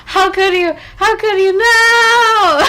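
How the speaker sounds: high, loud protest broken by laughter; laughing mock outrage. Real, not acted. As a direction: squeal no through the giggles. Reacting to a teasing clip.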